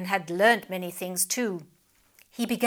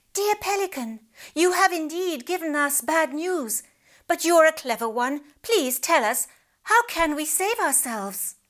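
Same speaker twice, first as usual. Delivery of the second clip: pleading big fish; 7 semitones higher than usual. Acted.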